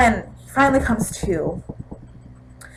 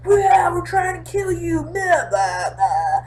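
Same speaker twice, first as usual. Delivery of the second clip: zombie voice; 8 semitones higher, slower than usual. Retold or imitated. Voicing zombies chasing Rick.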